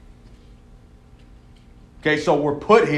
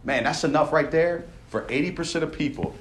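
ordinary talk, nothing marked

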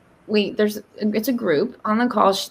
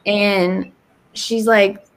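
ordinary delivery

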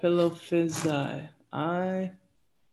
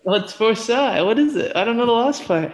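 louder flustered questions; loud puzzled pleading; 5 semitones higher, 7 dB louder than usual. Real, not acted. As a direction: call out confused. Confused guessing.

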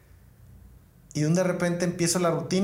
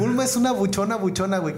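excited insistence; 5 semitones higher than usual. Real, not acted. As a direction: push the joke hard and excited. Insisting on his comic premise.

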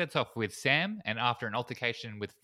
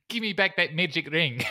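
raised, hammed-up Kiwi accent; bad New Zealand accent; 7 semitones higher, 5 dB louder than usual. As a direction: put on a broad, terrible New Zealand accent. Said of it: "the terrible New Zealand thing".

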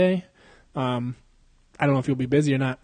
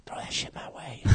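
whispered, much softer aside; sly whisper; 16 dB quieter than usual. Pretend. Host asking listeners for a hookup, sly whisper. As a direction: whisper it as a cheeky plea.

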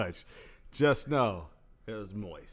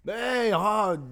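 usual speaking voice

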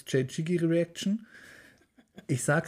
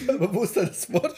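words through laughter, higher; laughing while talking; 6 semitones higher than usual. Real, not acted. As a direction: talk through the giggles.

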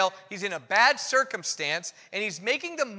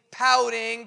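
ordinary talk

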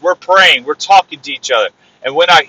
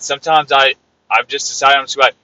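ordinary talk, nothing marked

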